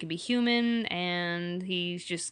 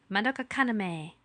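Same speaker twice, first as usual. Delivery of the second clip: voice actress impression; 2 semitones higher than usual. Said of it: "Like Wendy Lee".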